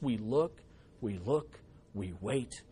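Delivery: preacher hushing his voice to the church, intimate; hushed. Acted. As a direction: draw the congregation in with a hush. Preacher drops to a hush.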